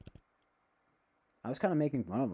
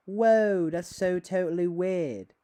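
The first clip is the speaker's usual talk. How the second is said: loud, higher surfer-dude outrage; mock surfer shout; 6 semitones higher, 9 dB louder, slower than usual. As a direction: belt it like a stoned surfer. Hammed-up surfer reaction.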